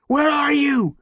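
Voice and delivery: high, loud anxious call. Acted. Brambleclaw searching for Squirrelpaw, anxious call. Calling through fallen branches.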